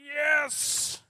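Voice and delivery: high, loud exultant cheer; acted burst of delight; triumphant yes. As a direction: celebrate like a proud father. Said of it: "And He's just like".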